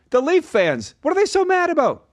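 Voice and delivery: very high, puzzled pizza man; imitated pizza guy. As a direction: ask it high-pitched, mimicking a baffled pizza guy. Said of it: "he's making the pizza".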